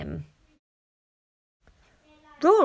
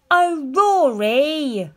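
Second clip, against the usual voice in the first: slow, loud exasperated cry; big sister wailing at the flooded bathroom; 6 semitones higher, 7 dB louder, much slower than usual. Acted. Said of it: "cried Tina".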